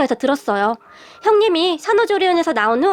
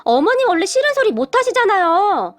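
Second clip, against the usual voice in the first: higher, drawn-out incredulous protest; surprised disbelief; 5 semitones higher, slower than usual. A narrator's reading. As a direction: act out a startled daughter-in-law who can't believe it. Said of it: "제가 놀라서 물었는데요".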